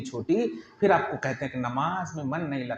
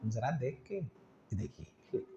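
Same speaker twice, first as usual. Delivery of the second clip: soft smiling; 5 semitones lower, 15 dB quieter, slower than usual. Shown as a demonstration. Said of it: "मुस्कुरा कर".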